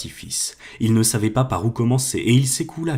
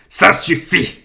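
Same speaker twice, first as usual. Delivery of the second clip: louder, higher barked order; commanding; 7 semitones higher, 6 dB louder, much slower than usual. A narrator's reading. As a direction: order him to stop. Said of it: "ordonna-t-il".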